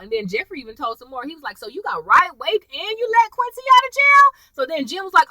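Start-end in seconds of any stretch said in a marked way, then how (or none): 1.6-4.3 high, mock-accusing question; acted incredulous confrontation. Son confronting his mother, mock disbelief. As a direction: pile up the charges in disbelief.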